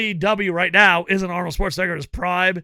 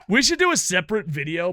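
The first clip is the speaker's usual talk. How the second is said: usual speaking voice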